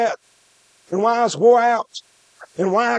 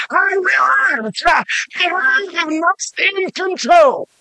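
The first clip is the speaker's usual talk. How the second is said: preaching; 5 semitones higher than usual. Acted. Preacher builds to altar call.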